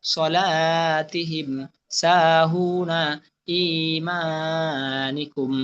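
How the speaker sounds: very slow, long held vowels; pronunciation model. Shown as a demonstration. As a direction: stretch the madd in each word. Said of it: "এরপর আপনারা উচ্চারণ পড়বেন". (টান দিয়ে ধীরে উচ্চারণ)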